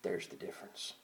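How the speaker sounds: breathy near-whisper; hushed whisper. Acted. Preacher hushing the church to drive the point home, whispered. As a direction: drop it to a whisper. Hushed punchline after mock quote.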